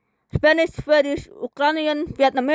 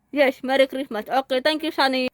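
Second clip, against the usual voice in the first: ordinary talk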